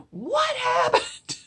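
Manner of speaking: high, slow startled cry; mock startled. Hot dog cooks startled by the ballad, high surprised cry. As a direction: act out the cooks' shock. Startled diner staff react.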